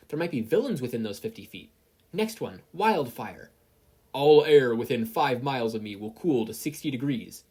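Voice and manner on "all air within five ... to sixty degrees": loud commanding decree; forceful order. Acted. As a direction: proclaim it like a law of nature.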